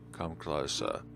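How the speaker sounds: ordinary delivery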